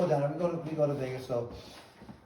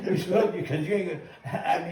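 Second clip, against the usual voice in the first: louder, higher, broken up by laughter; laughing; 3 semitones higher, 4 dB louder than usual. Real, not acted. Boxer ribbing a would-be opponent, laughing banter. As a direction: laugh through the teasing comeback.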